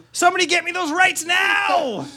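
high-pitched, hammed-up executive yell; excited demanding shout. Executive demanding reboot rights from staff, hammed-up high shout. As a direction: shriek the order across the office.

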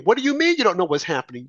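usual speaking voice

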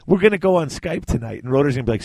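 usual speaking voice

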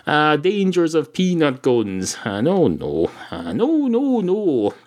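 imitating Adam Carolla. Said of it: "He says".

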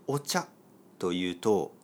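normal talking voice

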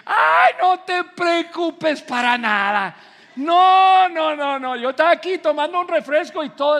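high, loud, gushing dismissal of an apology; hammed-up "no trouble at all" voice; over-eager reassurance. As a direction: wave off the apology far too eagerly. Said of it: "tú vas a decir".

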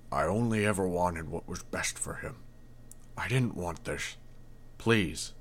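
a father begging, barely holding together; choked pleading. A narrator's reading. The mayor begging the hero to save his son, quietly choked. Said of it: "his voice suddenly choked with emotion".

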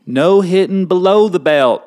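high, loud mock Texas accent. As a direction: announce the rule like a Texan. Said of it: "We're in Texas, at Austin".

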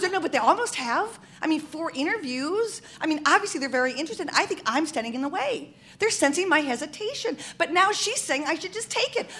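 high, eager run of argument; excited insisting. Retold or imitated. Wife arguing about the job with her husband, excited insisting. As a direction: talk the husband into it, high and keyed up.

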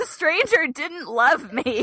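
mock wounded. Host fretting over one negative review, mock heartbroken. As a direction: pretend to be crushed by one bad review.

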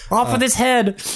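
mock executioner. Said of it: "I'm gonna be Ned Stark here".

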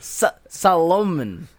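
Solomon as sah-LOH-mun, slow; joke mispronunciation. Shown as a demonstration. Friend adding another joke name, Solomon slowly stressed on LOH. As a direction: stretch Solomon with the stress on the middle.

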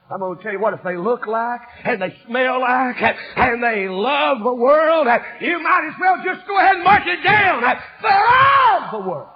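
rising revival preaching; building sermon. Acted.

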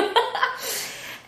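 high airy laugh; breathy laugh. Real, not acted. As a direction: laugh under the breath, amused. Laughing at friend's joke.

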